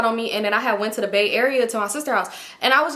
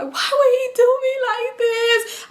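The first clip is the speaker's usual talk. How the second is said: very high, drawn-out wail; hammed-up sobbing plea, stretched out; mock crying scream; 3 dB louder, slower than usual. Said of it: "I'm in there screaming, talking to myself, crying".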